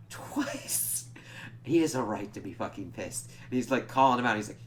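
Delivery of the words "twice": unvoiced-sounding, incredulous whisper of emphasis; outraged whisper. Real, not acted. Fan appalled he was shipped across the Atlantic, outraged whisper. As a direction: drop to a whisper for dramatic outrage.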